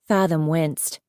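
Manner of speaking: plain reading voice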